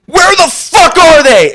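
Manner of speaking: slow, high, roaring shout of a scoutmaster who never swears; enraged shout. Retold or imitated. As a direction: let the fury explode on every word. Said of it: "he was so fucking pissed".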